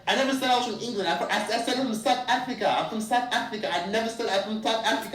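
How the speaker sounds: high-pitched insistent protest; retold arguing back. Tourist insisting to driver on being South African, arguing back. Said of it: "literally yelling and going back and forth".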